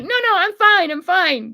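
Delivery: high, loud, flustered denial; flustered insistence. Retold or imitated. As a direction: insist you're fine, flustered. Retold denial to 911 call.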